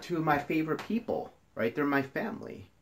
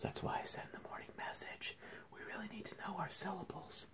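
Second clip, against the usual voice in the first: whispered aside; 11 semitones higher, 15 dB quieter, faster than usual. Real, not acted. Teacher slipping class a reminder mid-reading, whispered.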